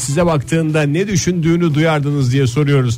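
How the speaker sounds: bright upbeat radio host read; radio announcer. Acted. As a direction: announce it like on-air.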